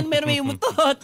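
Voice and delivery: scandalized passenger, high and mock-shocked; high mock complaint.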